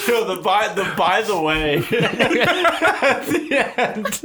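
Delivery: high, slow, laughing through it. Real, not acted. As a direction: stretch it out while cracking up.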